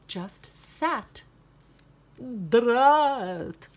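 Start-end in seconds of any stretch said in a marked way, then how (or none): 2.5-3.7 loud vampire curse; angry vampire. Acted. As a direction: spit it out. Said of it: "said the vampire".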